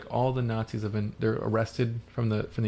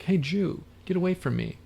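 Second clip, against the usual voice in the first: higher-pitched acted rejection; mock taunt, pitched up; pretend hostility; 7 semitones higher than usual. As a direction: put on a show of pushing someone away.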